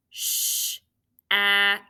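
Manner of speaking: each sound tapped apart, Sh-a-k; tapping out sounds. Shown as a demonstration. Teacher sounding out a word for students, tapped. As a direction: sound out Sh-a-k slowly, one tap per sound. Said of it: "Let's tap it".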